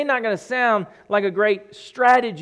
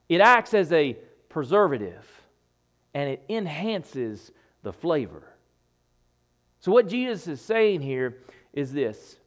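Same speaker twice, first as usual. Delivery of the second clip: usual speaking voice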